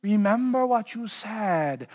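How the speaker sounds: quiet, slow, sly evil counsellors; a hint of hissing villains, soft and slow; evil schemers' voice. Governors pressing the king, lightly voiced as sly villains. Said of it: "why evil people always talk that way".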